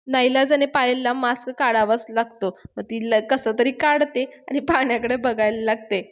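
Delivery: plain reading voice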